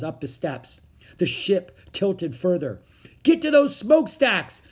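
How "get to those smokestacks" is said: high, loud yelled order; urgent raised yell; urgent cry. A narrator's reading. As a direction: shout to get someone moving fast. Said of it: "cried Jack".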